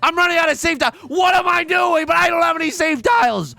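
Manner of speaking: high, loud frantic rant; panicked yelling. Real, not acted. Panicking over dangerous tiles in game.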